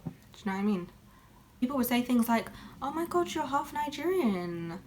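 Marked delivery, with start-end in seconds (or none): none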